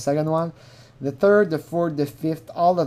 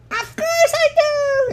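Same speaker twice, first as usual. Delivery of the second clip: imitated high, drawn-out girlish yell; yelled to stop laughing; 4 dB louder, slower than usual. Imitating a girl who has to stop laughing, high overexcited yell. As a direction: squeal it high and slow, covering a laugh. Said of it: "she's gonna yell, but she's gonna yell mostly because".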